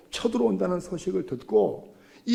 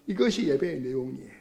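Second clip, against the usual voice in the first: normal talking voice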